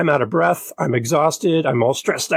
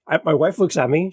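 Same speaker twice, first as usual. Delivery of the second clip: normal talking voice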